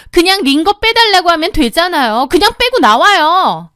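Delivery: high, loud, bossy whine; bratty order. Acted. Sister-in-law telling her to pull the IV, bossy. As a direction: order her around like a servant.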